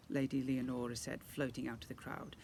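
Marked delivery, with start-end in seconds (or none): none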